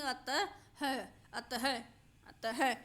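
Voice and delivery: अतः said as "atah" with a clear h at the end; अतः with the final ha breathed out; visarga as ha. Shown as a demonstration. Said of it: "हा के रूप में pronounce करेंगे तो क्या बोलेंगे".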